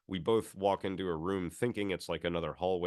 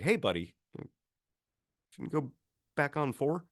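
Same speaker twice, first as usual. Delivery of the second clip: higher, cooing to a pet; tour guide coaxing; 8 semitones higher than usual. Retold or imitated. Tour guide asks the creeper to go back, cooing. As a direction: sweet-talk it like a dog. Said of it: "and be like".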